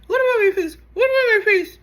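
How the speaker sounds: high, loud repeated indignant demand; mock outraged shriek. Angie demands Lisa explain the face insult, high indignant shriek. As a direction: shriek it over and over, offended. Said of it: "she's like".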